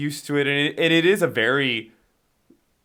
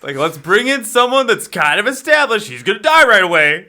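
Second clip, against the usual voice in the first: higher, louder producer pitching a casting idea; mock producer voice; 7 semitones higher, 9 dB louder than usual. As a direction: play the studio exec selling it. Mocking studio's casting logic.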